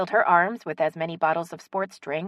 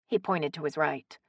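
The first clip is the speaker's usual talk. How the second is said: usual speaking voice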